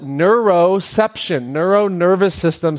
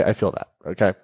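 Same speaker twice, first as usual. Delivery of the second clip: softer, lower repeat to herself; quiet echo; 11 semitones lower, 6 dB quieter than usual. Shown as a demonstration. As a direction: say it again under your breath. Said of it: "quiet echo of own line".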